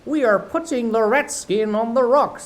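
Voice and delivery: high, loud pirate calling across water. Acted. Smee shouting to the captain, high pirate voice. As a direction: call out to the captain. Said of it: "Smee called out".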